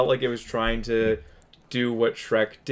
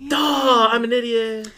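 self-mocking; 12 semitones higher, 4 dB louder, much slower than usual. Real, not acted.

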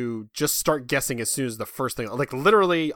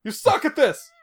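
mock taunt; 5 dB louder than usual. Mock insult to drawer.

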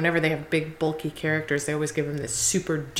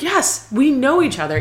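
pitched up and a bit louder, breezy and pleased; cheerful Thor quote; 8 semitones higher, 4 dB louder than usual. Retold or imitated. Podcast host quoting Thor's line to Hulk, pitched up and cheery. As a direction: chirp it brightly like an old pal. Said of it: "you know, he's like".

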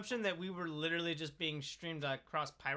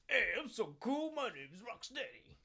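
lightly voiced cocky cartoon thug; a hint of a swaggering cartoon villain; voicing Rocksteady; 3 dB quieter than usual. Host voicing Rocksteady bragging to the co-hosts, lightly cocky. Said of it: "He's like".

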